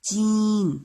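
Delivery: چین stressed and lifted for pupils to repeat; clear model word. Shown as a demonstration. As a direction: sound out چین with a long clear ee. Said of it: "اس کی آواز نکلتی ہے چین".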